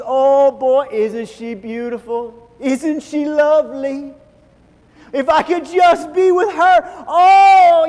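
hammed-up swoon building to a shout; lovestruck longing. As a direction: swoon over a beautiful woman. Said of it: "we walk into a place and we see".

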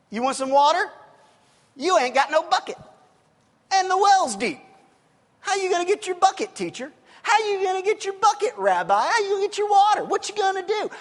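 loud, high, sassy challenge; copping an attitude. Retold or imitated. Woman at the well taunting the teacher, full of attitude. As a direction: throw it at him with mouthy, scornful sass. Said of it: "she's copping an attitude".

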